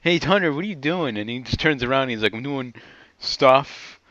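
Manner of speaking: normal talking voice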